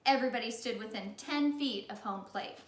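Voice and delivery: ordinary delivery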